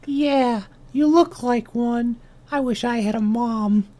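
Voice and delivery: high-pitched tiny ant voice; little ant. Acted. Runt ant talking to the owl, high little voice. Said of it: "And then the ant says".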